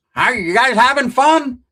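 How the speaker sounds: high, slow, loud sweet-lady voice; sing-song shopkeeper greeting. Retold or imitated. To fishermen at the bait store, high slow sugary greeting. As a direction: drag out the greeting like a too-friendly clerk.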